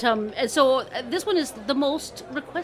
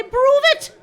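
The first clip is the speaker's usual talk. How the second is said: high, loud dare; challenging; 10 dB louder than usual. Acted. Two crows challenging the boaster, daring. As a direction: throw down a sharp challenge.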